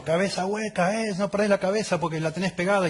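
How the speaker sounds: hammed-up exasperated dad telling off his kid; mock scolding. Father scolding son over a lost towel, high hammed-up rebuke. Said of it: "Y le digo".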